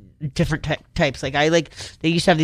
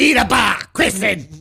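imitating mom; 5 semitones higher than usual. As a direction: scold like an exasperated mother. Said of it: "when you imitate your mom".

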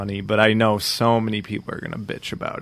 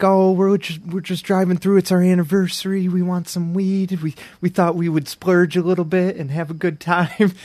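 lightly voiced tourist couple; 7 semitones higher than usual. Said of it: "They're like".

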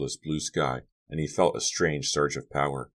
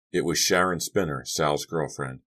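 ordinary delivery

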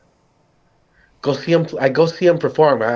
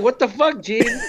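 mock indignant; 9 semitones higher than usual. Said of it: "I'm like".

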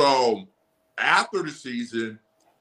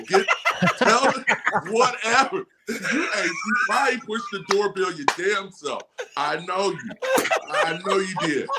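laughing tease; 5 semitones higher than usual. Real, not acted. Teasing wife about the doorbell.

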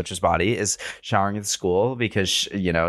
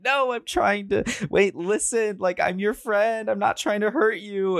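high mock pleading, pitched much higher; pleading Millie; 12 semitones higher than usual. Millie begging her friends, high hammed-up pleading. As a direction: beg them to listen, hammed up. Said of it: "Millie's just like".

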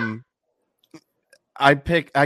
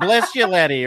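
high, loud hammed-up imitation; mock relatives; 8 semitones higher, 9 dB louder than usual. Imitating Maine family teasing him, loud and high. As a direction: belt out a mock folksy blessing. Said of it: "they would".

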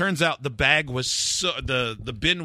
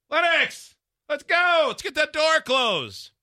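put-on much higher, louder hurried call to the kid; high-strung call; 3 dB louder, slower than usual. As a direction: call out to the kid like a wound-up parent. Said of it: "I'm being a spaz, high-strung".

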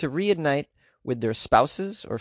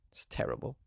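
whisper; 13 dB quieter than usual. Real, not acted. Whispered aside after laugh.

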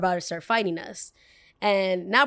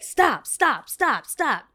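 raised voice, higher and louder; commanding stop; 5 semitones higher, 4 dB louder, much slower than usual. Retold or imitated. Rev pushing the girl away, shouting loudly to stop. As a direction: shout them to stop like breaking up a fight.

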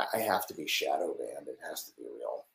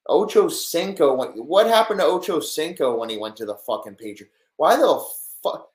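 higher, louder disbelief at the trade; higher, louder fan outraged by a trade; 8 semitones higher, 7 dB louder than usual. Real, not acted. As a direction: rage at a stupid trade.

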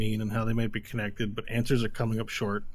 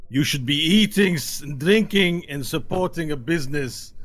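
loud, higher, slow accusing rant; angry accusation; 4 semitones higher, 4 dB louder than usual. Acted. Drunk tiefling berating a fellow customer as traitor. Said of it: "one of them goes up".

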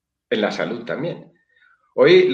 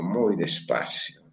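quieter, slower repeat; soft slow echo; 3 semitones lower, 7 dB quieter, slower than usual. Shown as a demonstration. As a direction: let it trail off like a breath.

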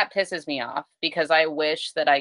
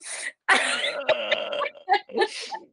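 high, drawn-out laugh; real amused laugh at a funny story. Real, not acted. Laughing at the child impression.

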